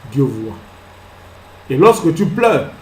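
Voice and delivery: normal talking voice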